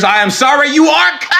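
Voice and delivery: high hammed-up shout on "you are cooked"; mock wailing shout. Telling viewers they're doomed, hammed-up high shout. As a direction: cry the verdict out like a mock lament.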